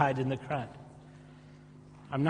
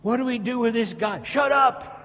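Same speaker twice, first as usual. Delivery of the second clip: exasperated crowd; 7 semitones higher than usual. Acted.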